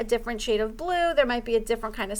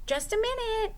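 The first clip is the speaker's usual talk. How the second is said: higher, calling out lightly; lightly voiced owl; 11 semitones higher, 4 dB quieter than usual. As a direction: owl answering the door. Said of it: "said Owl".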